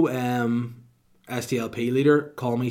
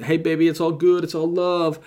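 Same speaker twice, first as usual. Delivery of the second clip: smooth American rapper voice; 6 semitones higher, 6 dB louder than usual. Pretend. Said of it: "American rapper".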